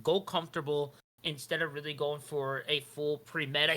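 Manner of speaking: ordinary delivery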